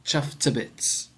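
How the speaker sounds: Chuffed to bits with to as a quick t sound; slow Chuffed to bits, to clipped to t; to reduced to t. Shown as a demonstration. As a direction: say to as just t, no vowel. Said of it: "Listen again to the pronunciation of this".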